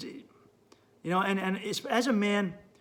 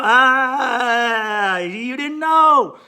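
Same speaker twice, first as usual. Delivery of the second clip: loud, slow, higher teasing ah; knowing drawl; 3 semitones higher, 10 dB louder, much slower than usual. Retold or imitated. He teases a partner who just learned, slow and knowing. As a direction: stretch it out, savoring their surprise. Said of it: "I go".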